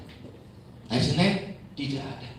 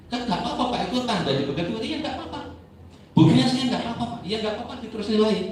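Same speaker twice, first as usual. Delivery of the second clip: higher, fast acted exchange; light back-and-forth; 9 semitones higher, 3 dB louder, faster than usual. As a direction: toss the lines quickly between the two. Congregant dialogue acted out.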